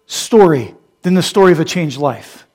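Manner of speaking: loud, high preaching at full force; fiery preaching. Acted. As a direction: preach it hard to the room.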